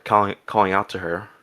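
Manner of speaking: ordinary delivery, nothing marked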